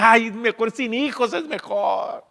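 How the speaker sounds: high, loud exasperated advice; mock parental exhaustion; tired parent. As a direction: sigh it like a worn-out parent. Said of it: "los que tienen hijos dicen".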